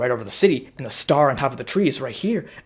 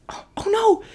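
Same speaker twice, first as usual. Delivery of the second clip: put-on very high kid dismay; high-pitched oh no; squeaky dismay. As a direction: gasp like little kids. Kids dismayed.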